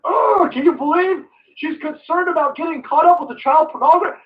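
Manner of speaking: high, wailing mock protest; mock outrage. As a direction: wail it like a fake-concerned fan. Mocking outraged voice.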